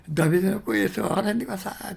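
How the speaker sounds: a blind beggar yelling after Jesus; loud cry. Acted. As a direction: cry out to be heard over the crowd. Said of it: "と叫んだ".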